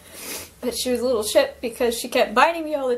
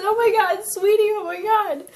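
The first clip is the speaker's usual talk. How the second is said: higher, overjoyed cry of her bird's name; overjoyed disbelief; 7 semitones higher than usual. Retold or imitated. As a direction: gasp it out like seeing a lost friend again. Retelling reunion with her bird.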